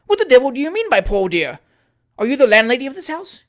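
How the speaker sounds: high, loud angry questioning; indignant demand. Acted. Trott demanding who the landlady is, angrily loud. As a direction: demand answers, bristling.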